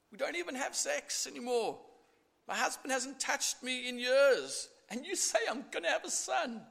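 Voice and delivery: high, bitter scoffing voice; bitter, smirky Sarah. Acted. Sarah scoffing in the tent, high and bitter. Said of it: "this smirky kind of, um, laugh".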